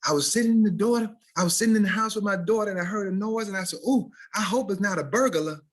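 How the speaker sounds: high, fast mock TV-interview lady; hammed-up witness on the news; imitating the lady. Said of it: "this lady once was saying".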